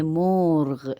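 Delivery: مورغ as one long syllable, "moorgh", no final ee; Persian pronunciation. Shown as a demonstration. Teacher contrasts it with Urdu murghi, Persian pronunciation. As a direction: stretch مورغ and stop on the gh. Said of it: "بس آخر کے یا نہیں ہے".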